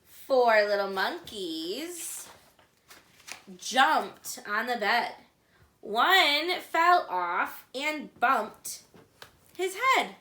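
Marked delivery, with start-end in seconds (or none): none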